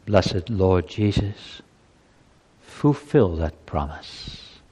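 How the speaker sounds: closing prayer. Acted.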